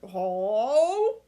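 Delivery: hello through toothbrush foam; burbling hello. A narrator's reading. Grandma answering mid-brushing, burbled hello. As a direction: talk around a toothbrush. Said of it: "she burbled".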